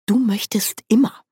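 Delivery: high, whispered tease; amused whisper. A narrator's reading. Nanny teases Grebo in a high whisper. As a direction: whisper it fondly.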